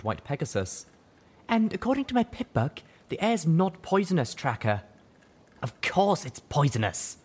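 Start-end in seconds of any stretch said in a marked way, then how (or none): 1.5-4.8 high, tired mare voice; wounded young mare. Acted. 5.6-7.2 slow, high, scornful snap; spat back. A narrator's reading. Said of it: "spat back".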